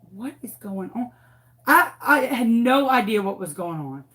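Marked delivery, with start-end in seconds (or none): 0.1-1.1 quiet, puzzled thought to herself; hushed inner thought. Retold or imitated. Teacher wonders silently in front of observers, hushed and puzzled. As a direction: think it softly, bewildered. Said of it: "I'm thinking".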